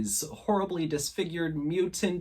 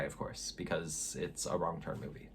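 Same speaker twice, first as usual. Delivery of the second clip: muttered aside; 9 semitones lower, 13 dB quieter than usual. Real, not acted. Sarcastic aside under breath.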